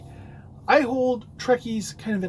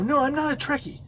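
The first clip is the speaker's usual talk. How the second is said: firm denial; 7 semitones higher than usual. Retold or imitated. Denying to teasing friend.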